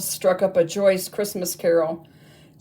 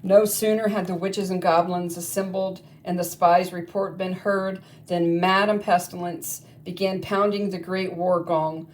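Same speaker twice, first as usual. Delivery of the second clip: ordinary delivery, nothing marked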